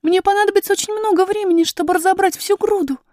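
put-on high girlish voice, daunted by the task; high, piping child's voice; worried young princess. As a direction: fret aloud as a little princess. Princess's figure in the story.

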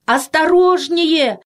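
high, drawn-out warning call; crowd's cry. A narrator's reading. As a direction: cry out a warning to someone above. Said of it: "закричала толпа". (протяжный предостерегающий крик)

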